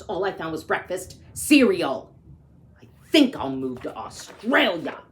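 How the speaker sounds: lively read-aloud storytelling; story narration. A narrator's reading. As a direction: read it like a picture book to kids. Reads picture book aloud.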